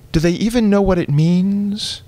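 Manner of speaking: a fussy mother asking innocently; mother's voice. Retold or imitated. Son voicing his mother's puzzled question, high and prim.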